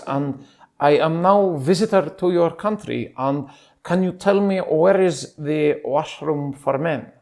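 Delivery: put-on a lost visitor asking for the washroom, Arabic-Persian accent. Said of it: "a mix of an Arabic and a Persian accent".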